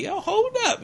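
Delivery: very high-pitched acted surprise; imitating amazement, voice leaping way up; startled hold-up. Woman amazed at the soldier's skill, high startled voice.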